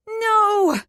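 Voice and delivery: high, loud piercing scream. A narrator's reading. Ashlyn screams against the promise, high and loud. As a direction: scream it out in protest. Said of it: "Ashlyn's scream cut through the air".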